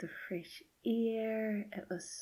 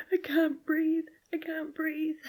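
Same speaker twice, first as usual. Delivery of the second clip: much higher and louder, gasping panic; panicked child; 10 semitones higher, 8 dB louder than usual. Retold or imitated. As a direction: cry it out like a terrified little girl who can't get air. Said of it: "She was crying".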